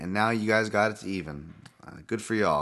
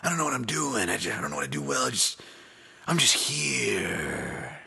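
clueless fighter voice; 4 semitones higher than usual. Retold or imitated. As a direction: act the lost fighter at his introduction. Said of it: "I just see a guy going".

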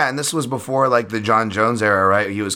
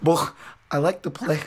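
a meek shrug of a fighter's reply; imitating Paul Sass; 6 semitones higher than usual. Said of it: "Paul Sass essentially was just like".

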